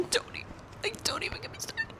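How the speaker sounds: airless, high whisper; words squeezed out, choked; strained whisper. Real, not acted. Reader choking up over the ending, whispering. Too emotional to talk about book.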